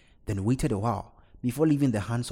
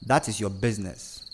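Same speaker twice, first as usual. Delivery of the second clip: usual speaking voice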